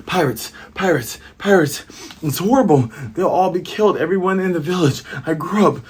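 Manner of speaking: high, loud frantic alarm; panicked shouting. Acted. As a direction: scream the warning while running. Usopp running, warning the village.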